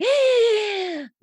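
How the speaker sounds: retold excited gasp. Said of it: "and I'm like".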